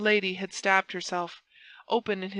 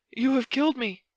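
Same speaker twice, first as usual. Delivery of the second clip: raised, higher cry; 5 semitones higher, 4 dB louder than usual. A narrator's reading. Buckingham stabbed by Felton, crying out. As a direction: exclaim as if mortally wounded. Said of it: "cried Buckingham".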